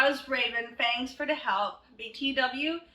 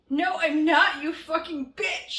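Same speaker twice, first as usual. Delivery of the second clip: loud, higher snarl of a reply; angry shout; 6 semitones higher, 5 dB louder than usual. Acted. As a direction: spit it out as an insult. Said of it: "he shouted angrily".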